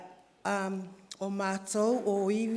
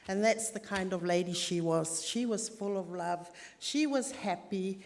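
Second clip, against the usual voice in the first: normal talking voice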